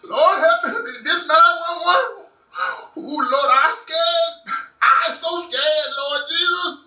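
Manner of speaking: high, slow, hammed-up crying plea to Jesus; fake panicked caller. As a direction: overact a terrified call to the police. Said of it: "start bucking your eyes and crying".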